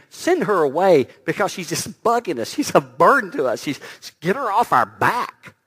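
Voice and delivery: loud, exasperated complaint; fed up, get her away; annoyed disciples. Acted. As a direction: disciples pleading loudly to be rid of her. Disciples annoyed, begging Jesus.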